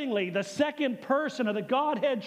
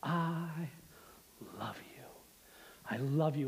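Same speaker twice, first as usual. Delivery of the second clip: softer and lower, fading into a whisper; tender whisper; 8 semitones lower, 10 dB quieter than usual. Acted. Pastor voicing God telling each listener he loves them, whispered. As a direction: breathe it like a tender secret. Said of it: "says to you".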